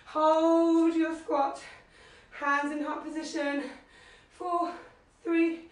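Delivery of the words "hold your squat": loud. Real, not acted. Coach calling hold.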